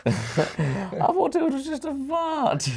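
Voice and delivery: very high, drawn-out mimicking voice; slow comic squeak of a quote; sitcom impression. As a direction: stretch the quote out slowly in a high put-on voice. Echoes the same sitcom quote.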